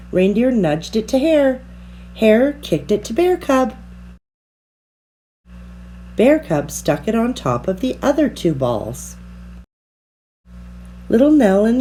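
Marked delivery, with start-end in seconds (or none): none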